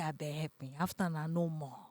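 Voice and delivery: a quiet retold plea to the doctor; begging. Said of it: "I was begging".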